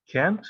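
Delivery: "can't" as one short syllable, rising; pronunciation demo. Shown as a demonstration. Teacher shows how cannot contracts, demonstrating "can't". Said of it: "se pronuncia tal cual así".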